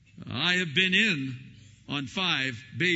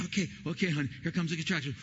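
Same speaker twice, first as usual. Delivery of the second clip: coaching breathing; 2 semitones higher, faster than usual. Retold or imitated. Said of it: "I'm trying to look at the machine".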